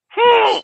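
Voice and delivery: high imitated puppy whimper; puppy whine. Dog bows head, whimpering.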